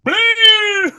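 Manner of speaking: very high, loud robotic alarm; imitated alarm blare. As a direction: blast it like a sentinel siren. Mutant detected.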